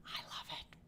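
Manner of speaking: breathy stage whisper echo; secretive whispered echo. Pretend. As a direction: hush it right after the shout. Repeats it as an echo.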